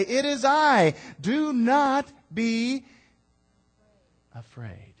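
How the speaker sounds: high, loud, slow call that drops to a hush on afraid; loud, slow voice of Jesus reassuring Peter. Acted. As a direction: call across the water, then soften the last word. Preacher voices Jesus on water.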